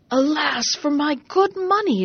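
high, loud cry of dismay over wasted money; dismayed exclamation. Acted. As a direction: wail it as if the money is gone. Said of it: "exclaimed the merchant".

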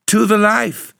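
high, loud cry of triumph; exultant. A narrator's reading. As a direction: exclaim it aloud in exultation. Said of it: "she exclaimed aloud exultantly".

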